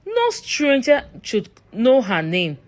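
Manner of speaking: ordinary delivery, nothing marked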